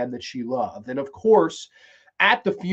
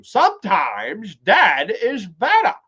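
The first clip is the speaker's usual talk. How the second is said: old man impression; 6 semitones higher than usual. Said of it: "the old man from Pet Sematary".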